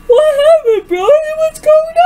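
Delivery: loud, high, frantic questions; panicked calling out. Acted. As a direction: call after him in a panic. Panicked calling after him.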